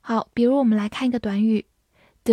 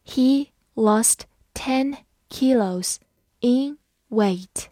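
slow, each word on its own; unhurried teacher's model with clear gaps; slow read-through; 2 semitones higher, slower than usual. Shown as a demonstration. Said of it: "好，慢慢来读".

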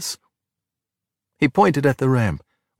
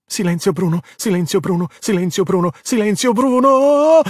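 yelling; 8 semitones higher than usual. A narrator's reading. Luca clinging on as the Vespa breaks, rising yell. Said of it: "Luca yelled".

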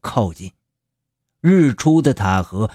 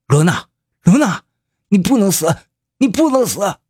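desperate call, much higher; crying out; 9 semitones higher than usual. Acted. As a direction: call her back from death. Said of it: "司徒长江呼喊他的名字".

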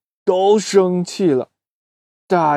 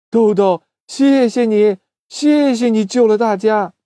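grateful thanks; 6 semitones higher, 3 dB louder than usual. Acted. Chickens thank Doudou.

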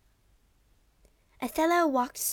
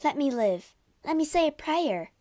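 high, louder, desperate begging; whimpering plea; 8 semitones higher, 5 dB louder than usual. A narrator's reading. Wife begs her husband for mercy, whimpering. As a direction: beg for life, pitch climbing. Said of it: "whimpered Desdemona".

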